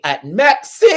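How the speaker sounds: high, loud, drawn-out "Maxine"; hammed-up imitation of a dramatic cry; mock cry. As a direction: wail the name, stretching the last syllable. Re-enacts the contestant's cry of Maxine.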